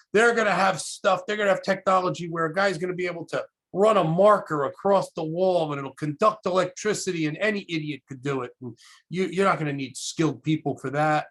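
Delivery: snapping retort. Retold or imitated. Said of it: "he snapped at me".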